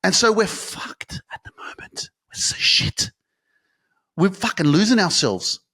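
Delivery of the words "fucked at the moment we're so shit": hushed, breath-only confession; despairing whisper. Acted. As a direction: whisper it as if defeated. Voicing despair at bad situation.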